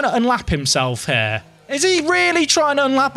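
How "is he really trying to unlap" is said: high, amazed question; can't believe it; surprised. Real, not acted. Surprised at unlapping.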